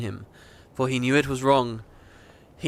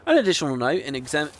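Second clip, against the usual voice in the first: rushed disclaimer read; faster than usual. Real, not acted. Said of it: "read it really quickly".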